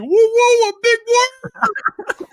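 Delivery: very high, loud yell cracking into laughter; mock yell. Imitating Big Worm in the lunch line, high loud laughing yell. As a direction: crow it out, laughing through the words. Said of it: "he's just going like".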